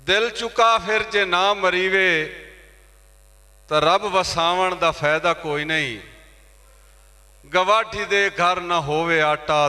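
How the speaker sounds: high, ringing openings that descend at each close; impassioned poetry recitation. Acted. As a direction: declaim the verse to the whole hall. Recites verse at mushaira. (ਜੋਸ਼ ਨਾਲ ਉੱਚੀ ਸੁਰ ਵਿੱਚ ਸ਼ਾਇਰੀ)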